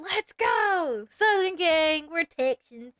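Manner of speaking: loud, high hype; rally the Southern crowd loudly; excited cheering. Real, not acted. Cheering a fellow Texan.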